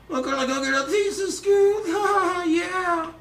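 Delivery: very high cartoon sidekick squeal; excited high-pitched cartoon stoner; Shaggy from Scooby-Doo. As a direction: push it way up into a cartoon voice. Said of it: "How about Shaggy from Scooby-Doo?".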